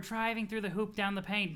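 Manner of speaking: normal talking voice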